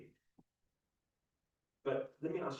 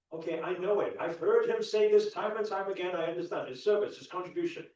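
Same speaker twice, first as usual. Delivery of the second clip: loud, raised, acted exasperation; impatient know-it-all; 6 semitones higher, 9 dB louder than usual. Imitating people who have heard the teacher many times, impatient know-it-all. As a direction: push through it as an impatient listener.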